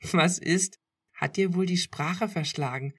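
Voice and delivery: giggling. A narrator's reading. Girlfriend teasing him for being speechless, giggling. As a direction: tease him, half giggling. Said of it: "kicherte sie".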